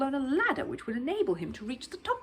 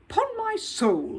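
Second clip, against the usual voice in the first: high, slow booming exclamation; pompous boom into the microphone; 12 semitones higher, 6 dB louder, much slower than usual. Acted. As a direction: boom it out like a flustered dignitary. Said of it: "he boomed into the microphone".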